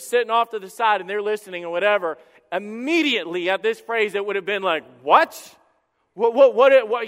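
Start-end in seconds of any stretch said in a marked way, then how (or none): 5.0-7.0 loud, rising what, then rapid loud repeats; worked-up shock. Acted. Preacher acts out Pharisees hearing the story, worked-up shock. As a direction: stammer in loud, indignant disbelief. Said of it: "they would have been worked up".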